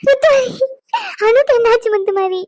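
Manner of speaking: tearful, voice breaking with sobs; crying grandmother. Acted. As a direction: plead through tears for news of her daughter. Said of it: "பாட்டி அழுவுறியே".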